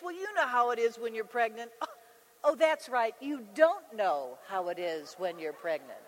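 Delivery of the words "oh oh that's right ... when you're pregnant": a touch of snide mockery; mock sweetness that drops low and cutting; a rival twisting the knife, lightly acted; catty jab.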